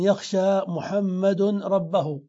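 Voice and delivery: slow, measured recitation with full case endings; slow example sentence. Shown as a demonstration. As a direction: spell out each word's ending unhurriedly. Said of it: "لو قلت مثلًا".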